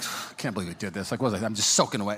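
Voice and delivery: low, grumbling self-pity, acted out; retold sulking. Soaked husband sulking at lunch, low retold grumble.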